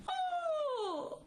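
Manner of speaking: a sudden very high strangled gasp as he passes out; fainting gasp. Acted. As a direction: choke it out high and thin, then go silent. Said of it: "背过气去了". (高声倒吸一口气，昏厥过去)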